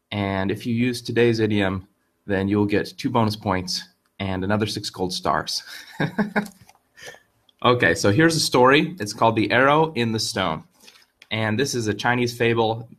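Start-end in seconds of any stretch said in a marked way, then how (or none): 5.7-6.5 quick high laugh at his own joke; a short bright giggle. Real, not acted. Teacher laughing at his own joke, giggling.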